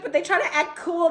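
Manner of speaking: ordinary talk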